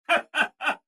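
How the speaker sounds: short, high chuckle; acted laugh. Robot reacting to the listener's claim, chuckling. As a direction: let out a quick, high-pitched staccato laugh.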